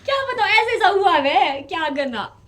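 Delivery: loud, high-pitched laughing protest. Real, not acted. Answering why she is laughing, laughing helplessly. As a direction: laugh helplessly through the words, easing off. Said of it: "क्यों हंस रहे हो?".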